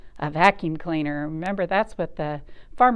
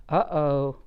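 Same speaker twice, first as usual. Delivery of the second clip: ordinary delivery, nothing marked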